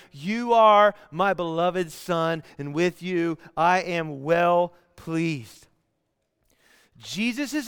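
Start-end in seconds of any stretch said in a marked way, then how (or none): 0.1-5.7 slow, measured pronouncement, a little lower; speaking clearly. Acted. God the Father over Jesus at baptism, slow clear pronouncement. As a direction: space the words out deliberately. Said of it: "speaking clearly over him".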